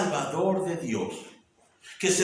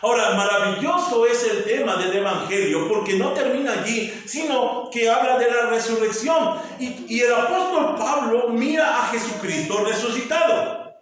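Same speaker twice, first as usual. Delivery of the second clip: higher, louder exultant proclamation; joyful preaching; 7 semitones higher, 5 dB louder than usual. Acted. Preacher announcing the resurrection to the congregation, exultant preaching.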